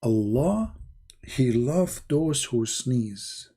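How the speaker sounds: slow, hushed, preachy recital; mock solemn recitation. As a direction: intone it slowly like a sermon.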